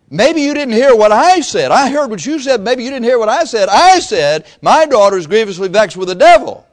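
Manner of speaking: high, loud, pressing insistence; mother insisting Jesus hear her; insistent plea. Retold or imitated. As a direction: demand to be heard, loud and high. Woman insisting to the Lord.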